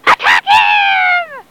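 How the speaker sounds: very high, drawn-out attack shout; battle cry. Acted. Pirate orders attack on Long John Silver, high drawn-out shout. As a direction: stretch it into a high rallying yell. Pirates turn on their leader.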